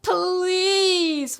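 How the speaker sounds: long, high, loud pleading wail. A narrator's reading. Suffering fox begging the dentist, wailing. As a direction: stretch out the please in misery. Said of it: "wailed the fox".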